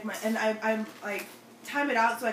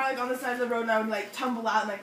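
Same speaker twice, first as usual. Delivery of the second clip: ordinary talk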